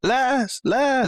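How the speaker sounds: high echoing call; a cry bouncing back through fog; high-pitched call with echo; distorted echo. Acted. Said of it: "more distorted echoes of his words".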